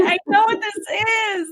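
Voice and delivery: high, tearful voice breaking with relief; emotional relief. Real, not acted. Guest telling host about her diagnosis, tearful relief. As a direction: let the relief choke you up.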